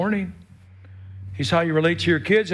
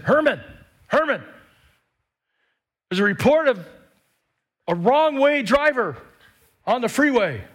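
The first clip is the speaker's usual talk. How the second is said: higher, louder alarmed wife calling out; 8 semitones higher, 3 dB louder than usual. Retold or imitated. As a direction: panic a little as the wife warning her husband. Wife calling husband urgently.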